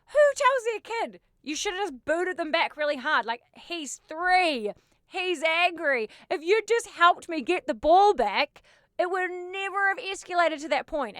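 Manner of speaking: vent, still outraged; indignant rant. Real, not acted. Ranting at the dad who encouraged kicking, indignant.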